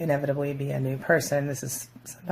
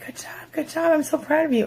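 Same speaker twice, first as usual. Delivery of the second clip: high, gushing "good job, good job"; proud partner praising a promotion, much higher and gushing; bright sincere praise; 12 semitones higher than usual. Retold or imitated. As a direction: cheer them on like you mean it. Genuine praise for a spouse.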